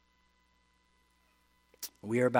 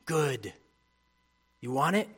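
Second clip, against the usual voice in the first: usual speaking voice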